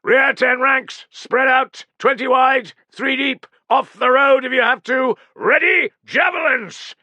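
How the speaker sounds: high, loud officer's commands; barked orders. Acted. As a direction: call the ranks into position over the noise. Officer giving battle orders.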